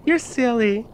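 put-on high childish teasing voice; faking madness. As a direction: tease in a high, odd girl voice. Claire teasing from the bed.

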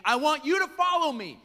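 high, loud, ringing invitation; Jesus calling out. Retold or imitated. Jesus inviting rejected fishermen, loud and high. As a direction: call them in as Jesus. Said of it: "Jesus is saying".

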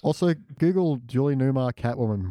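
ordinary delivery

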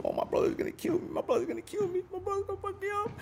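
high, fast, breathless panicked whisper; a scared kid fretting under his breath; panicked whimper. Retold or imitated.

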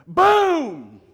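big, high, loud boom; sudden booming outburst; explosive exclamation. Acted. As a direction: burst it out like a punchline. Exclaims the big payoff of story.

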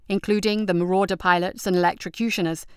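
ordinary delivery

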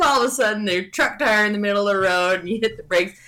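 usual speaking voice

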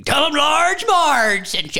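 high, slow spooky quote voice; drawn-out eerie movie quote; Large Marge line. Retold or imitated. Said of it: "so many iconic lines, including".

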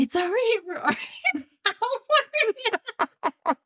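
high, squeaky laughing speech; helpless laughter. Real, not acted. Co-host breaking up over the episode's length, helpless laughter. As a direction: try to get words out through laughter. Overcome with giggles mid-sentence.

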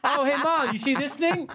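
high childish voice mocking a kid; mocking a bratty kid at the checkout, high childish voice; annoying kid. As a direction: squeal it like a bratty kid showing Mom everything. Mimicking annoying kid in line.

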